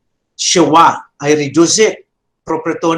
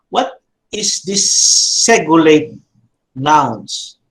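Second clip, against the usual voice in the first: ordinary delivery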